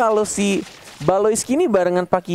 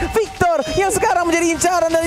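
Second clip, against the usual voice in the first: excited; 11 semitones higher than usual. Real, not acted. Caster calling a teamfight.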